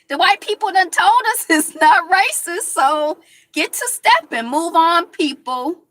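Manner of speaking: high, loud mocking imitation of the people in charge; sarcastic mock voice. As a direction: sneer it out like you're parroting a ruling you find absurd.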